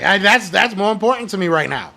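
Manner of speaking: ordinary delivery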